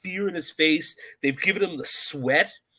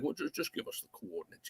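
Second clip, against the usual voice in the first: much softer, undertone impression; James Bond; 15 dB quieter than usual. Bond asking Quarrel for coordinates, hushed mock impression. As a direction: lightly play it as a quiet film spy. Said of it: "and Bond is like".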